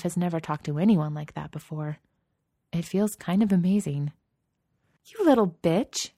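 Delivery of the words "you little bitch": high, loud, offended sneer. A narrator's reading. Katie turns on Genevieve with a high, outraged sneer. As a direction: spit the insult with indignant contempt. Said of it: "wrinkling back in an ugly sneer".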